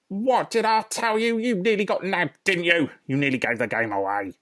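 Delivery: high, loud nasty rodent voice; shrieking Rat. Acted. Rat shrieking at the foxes from his shelf, high and loud. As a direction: screech it at the intruders.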